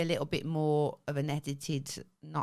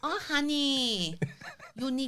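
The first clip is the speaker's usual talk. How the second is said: put-on Thai accent; 7 semitones higher, slower than usual. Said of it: "Your Thai accent safe space".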